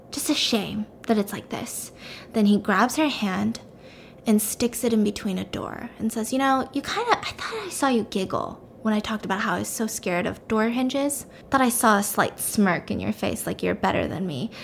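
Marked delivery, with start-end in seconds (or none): 0.1-1.9 much softer, wistful villain; quietly feigned regret; acted soft regret. Villain voices regret to captive. 6.3-14.5 softly voiced villain threat; quiet menace. Villain threatens captive at door.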